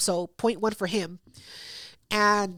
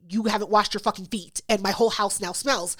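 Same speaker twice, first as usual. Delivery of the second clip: ordinary talk, nothing marked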